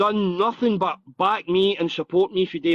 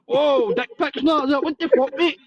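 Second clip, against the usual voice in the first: higher, appalled yelp; shocked recoil; 7 semitones higher, slower than usual. Retold or imitated. Retelling opening a stranger's Snapchat, mock shocked. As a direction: recoil from the picture on the screen.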